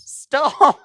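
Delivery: high, loud yell; shout. Real, not acted. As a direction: yell it to cut him off. Yelling at offensive joke.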